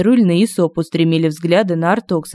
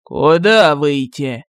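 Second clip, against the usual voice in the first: slow, lower disbelieving question; disbelief; 4 semitones lower, much slower than usual. A narrator's reading. General hears the order, slow disbelieving question. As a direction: ask it as if you misheard. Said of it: "не поверил Артокс".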